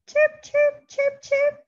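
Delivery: high, chirpy birdsong imitation; bird chirps. Mom imitating birds for her toddler, high chirping. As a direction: chirp like a little bird for the baby.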